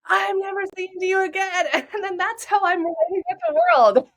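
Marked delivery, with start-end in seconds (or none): 0.1-1.7 put-on high, pouting little girl refusing to talk; sulking inner child. To her adult self, the neglected inner child sulks with a high pout. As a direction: play a hurt toddler giving the silent treatment. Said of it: "showing up as an upset three-year-old".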